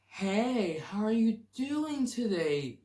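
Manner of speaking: high, loud, drawn-out patronizing hello; exaggerated slow, singsong greeting; condescending greeting. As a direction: stretch it out with overdone cheer, talking down. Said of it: "go up to someone who's special ed and go".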